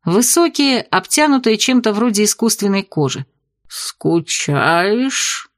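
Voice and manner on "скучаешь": low gurgling octopus voice; gurgling tentacle alien. Acted. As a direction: gurgle it like a sea creature. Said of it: "пробулькал осьминог". (булькающий голос осьминога)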